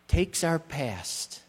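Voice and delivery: normal talking voice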